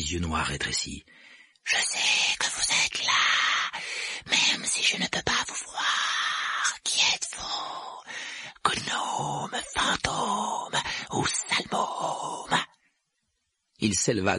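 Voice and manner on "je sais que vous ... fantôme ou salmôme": slow, drawn-out eerie rasp; creepy sing-out taunt, mostly breath; taunting poltergeist. A narrator's reading. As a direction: stretch each phrase in a whispery spook voice.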